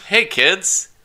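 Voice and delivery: put-on loud, high, peppy kids' commercial voice; infomercial. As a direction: pitch it like a cheesy TV ad to kids. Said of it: "Should read it like an actual ad".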